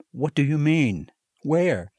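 papa taken aback; lightly voiced alarmed father. A narrator's reading. Papa asks, turning pale.

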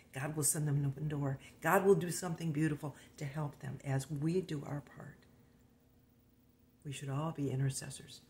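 ordinary talk, nothing marked

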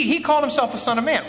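imagined objector's quick protest; mock objection. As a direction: toss it off fast and high, as an imagined objector. Voicing an imagined dismissive reply.

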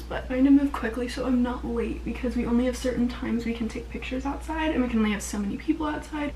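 normal talking voice

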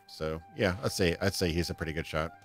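usual speaking voice

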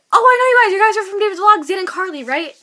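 high, loud starstruck greeting; excited recognition. Retold or imitated. Fan greeting [name] and [name], high excited recognition. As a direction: call out like spotting famous faces. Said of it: "I'm like".

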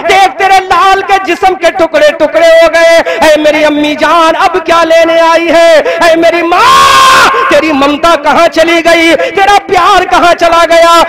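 impassioned majlis lament; preaching at full force. Acted. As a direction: cry it out to the gathering. Preacher recites Zainab and Fatima.

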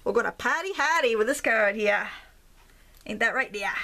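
playful thick Maine accent; down-home Maine accent. Shown as a demonstration. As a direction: lay on the Maine accent with dropped Rs. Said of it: "I'm from Maine, in case you can't tell".

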